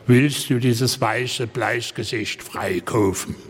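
slow, drawn-out Saxon quote; Saxon accent. Retold or imitated. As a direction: imitate a solemn line in slow Saxon. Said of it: "wieder auf Sächsisch natürlich".

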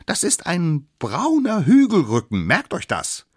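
high, loud, insistent correction; bossy little expert. Acted. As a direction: set them straight from up high. Youngest insists, scolds from above. (hoch, laut und belehrend)

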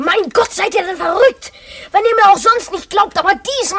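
high, loud exasperated protest; exasperated outburst. Acted. Boy pleading with doubting friends, loud and high. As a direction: burst out, begging them to believe. Kalle protests nobody believes him.

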